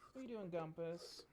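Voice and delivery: high sing-song coaxing, repeating the ask. Real, not acted. Streamer coaxing her dog onto the couch, high pet voice.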